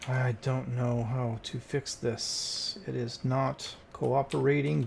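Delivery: slow, dragged-out complaint; slow weary grumble; drawn-out frustration. Real, not acted. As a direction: stretch every word, worn out. Stuck on the repair.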